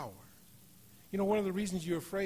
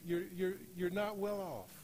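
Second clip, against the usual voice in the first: ordinary talk, nothing marked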